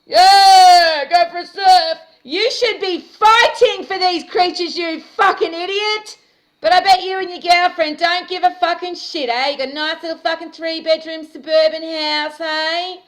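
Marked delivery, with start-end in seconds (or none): 0.0-8.3 yelling in real rage, much higher and louder; furious shouting. Real, not acted. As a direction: scream it at him, furious. Furious rant at a shark killer.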